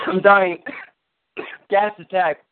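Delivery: mock choking cry. Joking about the fart smell.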